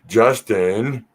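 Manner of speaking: usual speaking voice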